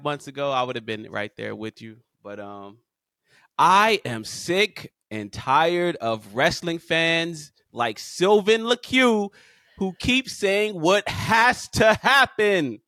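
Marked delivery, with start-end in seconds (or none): 3.6-12.8 very slow, every word punched; slow emphatic rant. Real, not acted. Cohost calling out his friend, slow and pointed. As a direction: spell out each word for emphasis.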